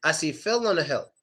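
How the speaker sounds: "Phil" and "hill" short and unweighted; no vowel stress. Shown as a demonstration. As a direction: keep the ih in "Phil" and "hill" light. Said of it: "There's no stress".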